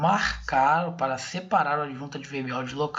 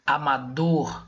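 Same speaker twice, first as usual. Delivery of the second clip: "amador" with a louder, drawn-out DOR; stress on DOR; 3 dB louder, slower than usual. Shown as a demonstration. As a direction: lean hard on the last syllable, DOR, in "amador". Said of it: "Pode ver que eu falo ela mais forte".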